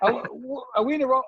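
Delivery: high, hammed-up innocent Aussie; strong Aussie accent. Aussie visitor asking the local if they erred, strong Aussie accent. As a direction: play up the Aussie, pitched high and clueless. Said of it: "couldn't hear a stronger Aussie accent".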